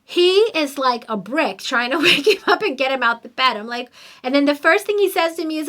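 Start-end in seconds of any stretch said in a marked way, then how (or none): none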